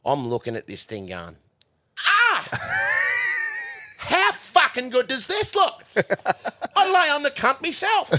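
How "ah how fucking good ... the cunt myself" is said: high, loud cry of self-admiration; exultant boasting. Retold or imitated. Young stablehand admiring his straw box, exultant yelling. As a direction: crow over your own handiwork, pitched way up.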